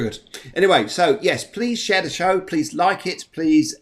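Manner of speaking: ordinary talk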